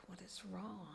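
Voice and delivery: soft worried whisper; whispered worry. Retold or imitated. Daughter across the table asking her crying mother, whispered worry. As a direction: whisper it, alarmed. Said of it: "she's looking at me like".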